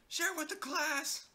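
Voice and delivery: teacher voice. Pretend. Teasing demand to show comment.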